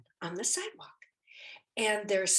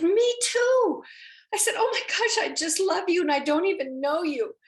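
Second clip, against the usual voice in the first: much higher and louder, bubbling over; gushing delight; 10 semitones higher, 9 dB louder than usual. Retold or imitated. To the woman who also helps Stephen, high gushing delight. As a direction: gush it out high and loud.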